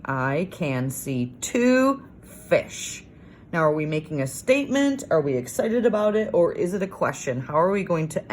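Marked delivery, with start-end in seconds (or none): 0.1-3.0 each word drawn out very slowly; slow careful reading. Shown as a demonstration. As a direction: read it back word by word to check it. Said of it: "Let's make sure our sentence sounds right".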